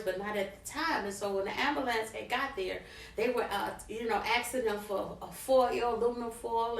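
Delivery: ordinary delivery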